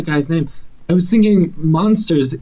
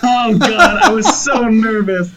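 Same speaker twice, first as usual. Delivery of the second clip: high relieved exclaiming; 8 semitones higher than usual. Real, not acted. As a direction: let the tension out. Relief after guessing right.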